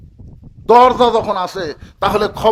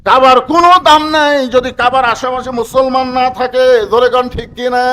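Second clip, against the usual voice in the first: raised, chanting waz delivery; full-force preaching; 6 semitones higher, 7 dB louder than usual. Real, not acted. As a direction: declaim it loudly to rouse the crowd. (সুরেলা চড়া গলায় ওয়াজ)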